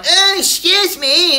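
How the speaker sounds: high, slow, prissy lady voice; hammed-up rude lady pushing in; mock lady excuse me. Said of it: "some lady going".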